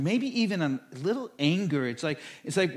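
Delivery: normal talking voice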